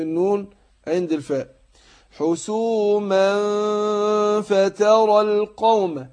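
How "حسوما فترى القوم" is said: slow chanted tajweed recitation, heavy nasal hum; Quran recitation. Shown as a demonstration. Teacher demonstrating recitation to students, slow chant. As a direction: recite slowly, drawing out the ghunna.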